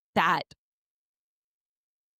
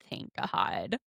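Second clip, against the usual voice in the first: low, soft mutter of relief; quietly retold grumble, pitched well down; 8 semitones lower, 7 dB quieter than usual. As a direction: mutter it low under your breath, as an imitated aside. Muttered aside in retold scene.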